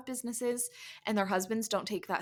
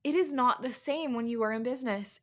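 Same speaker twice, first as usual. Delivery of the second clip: normal talking voice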